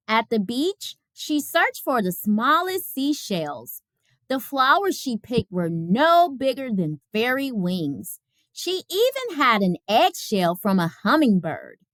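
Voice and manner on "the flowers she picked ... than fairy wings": usual speaking voice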